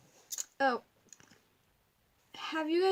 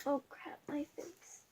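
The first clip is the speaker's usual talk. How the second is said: usual speaking voice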